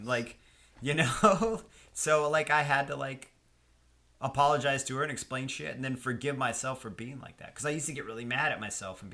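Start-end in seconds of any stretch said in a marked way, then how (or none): none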